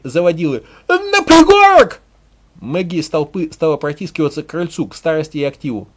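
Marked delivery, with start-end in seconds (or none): 0.9-2.0 very high, loud shriek; crazed female ringleader's scream; wild squealing cry. A narrator's reading. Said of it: "С привизгом, с дичинкой крик".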